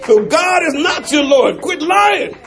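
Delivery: rising, shouted sermon call; climbing preacher's shout; preaching. Acted. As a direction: preach it hard, building. Preaching at full force.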